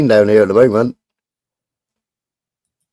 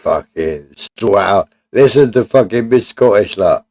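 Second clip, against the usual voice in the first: ordinary talk, nothing marked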